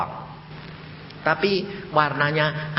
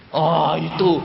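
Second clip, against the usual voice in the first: ordinary talk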